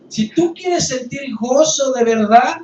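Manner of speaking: más alto, más fuerte y pausado; exhortación fuerte. Real, not acted. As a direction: clamar a la congregación. Exhortación culminante del sermón. (el pastor exhorta a la congregación, clamando fuerte)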